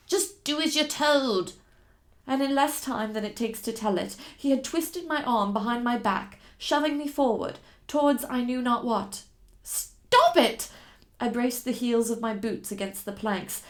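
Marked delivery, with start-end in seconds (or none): 0.1-1.5 menacing order. Acted. Guide turns captor, threatening. 9.6-10.7 loud, much higher furious cry. Acted. Captive fighting her captor, loud and high.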